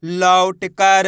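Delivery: "लौट कर" joined up, slow and loud; blended word, drawn out. Shown as a demonstration. Teacher answers what the letters make, slow blended word. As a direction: stretch the vowels of "लौट कर". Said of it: "तो बताइए मिलके क्या बनेगा".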